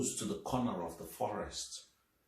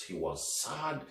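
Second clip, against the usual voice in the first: plain reading voice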